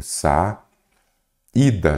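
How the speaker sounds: "sa, ida" split by a clear pause; sílaba por sílaba. Shown as a demonstration. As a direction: break "sa, ida" after "sa", stressing the i. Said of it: "vou separar em sílabas". ("sa, ida" separado em sílabas, com pausa)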